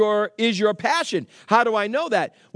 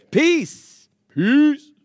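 loud proclamation; 5 semitones higher, 6 dB louder than usual. Real, not acted. Proclaiming peace loudly.

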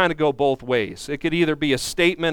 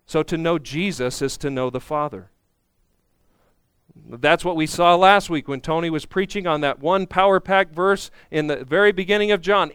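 ordinary talk

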